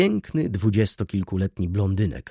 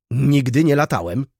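louder, indignant cry; shouting; 4 semitones higher, 6 dB louder than usual. A narrator's reading. Old clerk denying chasing skirts, with an indignant shout. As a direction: bang out the denial indignantly. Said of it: "krzyknął Rzecki".

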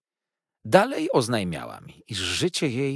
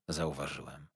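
usual speaking voice

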